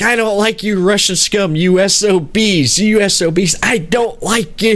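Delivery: hammed-up scolding, pitched high; in-character mock contempt. Host imitating Joyce insulting Russian captors, in-character mock contempt. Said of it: "she got really into character".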